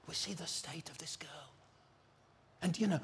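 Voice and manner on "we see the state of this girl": soft whispered aside, nearly in tears. Real, not acted. Moved telling of the girl.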